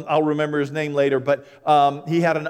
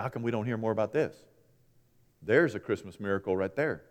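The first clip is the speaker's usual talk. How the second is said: normal talking voice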